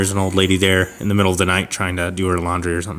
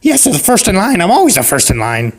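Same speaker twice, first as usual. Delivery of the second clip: hammed-up old lady; insistent old lady; 8 semitones higher than usual. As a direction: put on an old woman staking her claim. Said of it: "And she's like".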